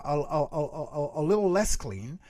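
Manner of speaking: usual speaking voice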